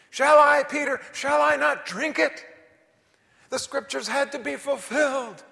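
loud, high impassioned preaching; fiery preacher pressing the point; preaching at full force. Acted. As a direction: shout it to the rafters.

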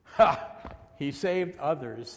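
scornful "Ha!" punched loud; mocking. Elders mocking Jesus on the cross, loud scorn. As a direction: open with a loud derisive burst, then sneer. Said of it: "and the elders mocked him".

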